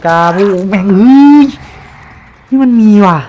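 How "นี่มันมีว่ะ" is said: high, loud alarmed shouting; frantic gamer shouts; panicked yelling. Real, not acted. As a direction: yell in real panic. Killer chasing, panicked yelling.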